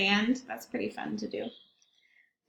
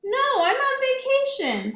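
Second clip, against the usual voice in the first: much higher, louder cheerful reply; high penguin voice; 13 semitones higher, 4 dB louder than usual. Acted. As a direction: chirp it proudly. Penguin answers crab.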